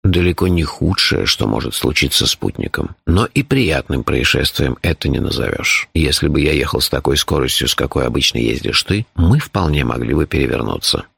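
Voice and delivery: deep, composed character voice; low, measured, matter-of-fact telling; phlegmatic Shurf. A narrator's reading. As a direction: explain danger with unruffled calm.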